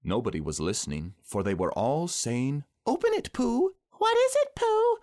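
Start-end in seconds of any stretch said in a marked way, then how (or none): 2.9-5.0 very high, loud squeaky urging; eager clamour. A narrator's reading. Friends urging Pooh to open it, eager clamour. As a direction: call out in tiny excited voices.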